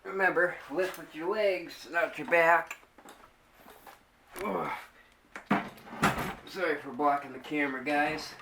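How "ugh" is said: effort grunt. Real, not acted. Lifting heavy playfield.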